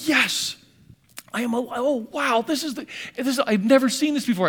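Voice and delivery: high, joyful cry of discovery; elated exclamation. Retold or imitated. Preacher recalling coming alive, elated cry. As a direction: burst out with joy. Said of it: "I was so alive to it".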